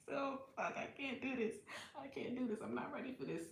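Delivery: losing her nerve under her breath; panicked muttering. Retold or imitated. Mother about to push, softly panicking to herself. Being leaned back to push.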